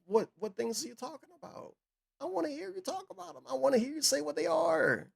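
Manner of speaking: high, squeaky exasperated demand; mock demanding voice. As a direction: squeak it out in disbelief. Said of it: "And I'm like".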